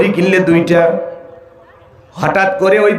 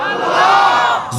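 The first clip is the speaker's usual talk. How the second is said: high, slow shouted name; shouted answer; 12 semitones higher, much slower than usual. Acted. Preacher answers his question, shouting high and slow. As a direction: cry the answer out long.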